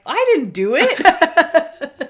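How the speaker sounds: high, slow, happy mock denial; gleeful high drawl. Podcaster quoting Monk's happy denial, high and slow. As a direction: stretch it out up high, beaming. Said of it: "And he's like".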